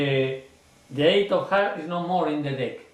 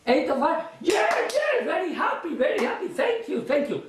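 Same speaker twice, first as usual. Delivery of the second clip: much higher, bursting with joy; overjoyed; 10 semitones higher than usual. Shown as a demonstration. Magician on stage, overjoyed by the named card. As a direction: gush with delight at the answer. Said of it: "I do like in the show".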